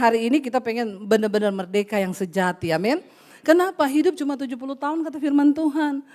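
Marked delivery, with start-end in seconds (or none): none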